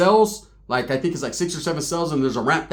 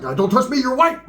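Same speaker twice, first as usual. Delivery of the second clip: higher, mocking echo; mock retort; 7 semitones higher than usual. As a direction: throw his words back at him. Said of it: "He was like".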